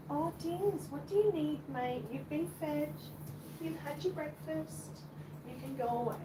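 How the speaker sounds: high, soft mock-scolding of a pet; fond pet talk. Real, not acted. Telling the fed cat to go away, high fond pet talk. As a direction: gently shoo a begging cat.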